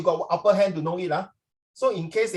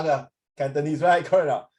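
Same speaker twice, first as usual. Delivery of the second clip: ordinary talk, nothing marked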